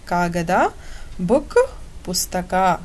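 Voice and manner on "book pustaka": usual speaking voice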